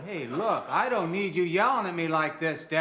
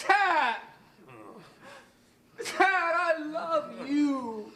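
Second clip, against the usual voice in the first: pleading cry; 11 semitones higher, much slower than usual. Acted. Son cries out pleading to father.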